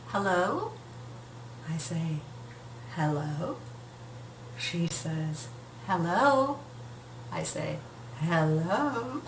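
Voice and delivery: low, very soft, hesitant hellos; tentative hush. Retold or imitated. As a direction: say it barely daring to hope. First call with birth mother.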